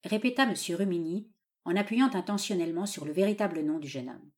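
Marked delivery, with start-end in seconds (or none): none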